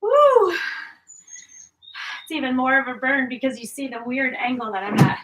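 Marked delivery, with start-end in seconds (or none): 0.0-0.5 high, loud whoo of effort; loud exertion whoop. Real, not acted. Exertion peak.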